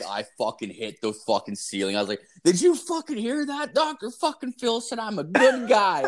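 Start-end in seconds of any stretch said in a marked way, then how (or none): 2.4-6.0 put-on much higher, shrieking with drunk joy; replaying a wild, thrilled outburst; very high excited squeal; drunk excitement. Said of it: "I was so drunk".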